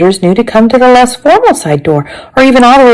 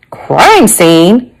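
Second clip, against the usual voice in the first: drawn-out, fully voiced question; grandmother's voiced query. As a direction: question it aloud as the surprised grandmother. Grandmother's query, against whispered repeat.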